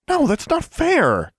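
very high, drawn-out outburst; outraged protest. Acted. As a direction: cry out against the injustice. Alexandra gasps, protests punishment.

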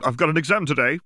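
a student suddenly remembering an exam; panicked gasp. A narrator's reading. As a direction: Hermione jolting up in bed, panicked and high. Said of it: "Hermione gasped".

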